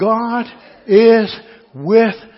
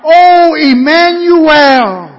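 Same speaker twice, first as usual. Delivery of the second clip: full-voiced, high, drawn-out cry; 7 semitones higher, 8 dB louder, much slower than usual. Preacher voicing troubled people calling on God, crying out. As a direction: shout it long and slow to God. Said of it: "that they cry out".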